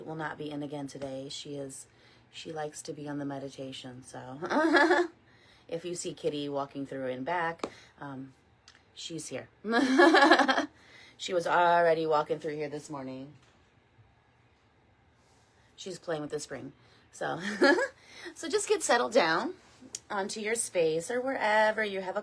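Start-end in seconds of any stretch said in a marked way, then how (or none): 4.4-5.1 loud, high burst of giggles; amused laugh. Real, not acted. Host joking that Kitty likes the meditation, amused laugh. 9.5-10.7 loud high peal of laughter; amused laugh. Real, not acted. Host spotting Kitty in the room, amused laugh. Cat turns up as she speaks. 17.4-18.3 short, loud high chuckle; quick high burst of laughter; amused laugh. Real, not acted. Host watching Kitty play with the spring, amused laugh.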